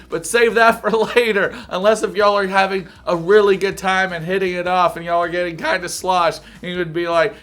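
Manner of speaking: normal talking voice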